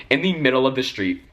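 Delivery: ordinary talk, nothing marked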